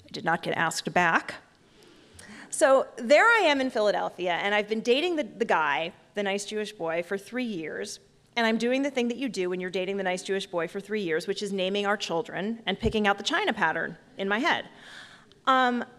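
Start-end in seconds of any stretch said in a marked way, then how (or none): none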